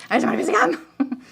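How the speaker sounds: hammed-up frustration over a small thing; mock angry. Woman griping about wanting gum, mock angry.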